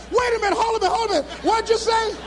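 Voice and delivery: high, panicky fending-off; frantic disbelief. Retold or imitated. Speaker interrupted while being dumped, frantic. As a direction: stall everyone, pitch right up in alarm.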